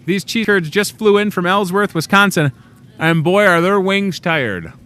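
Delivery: high, loud and cheerful; excited. Real, not acted. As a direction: announce it with big cheer. Cheerful announcement about the cheese curds.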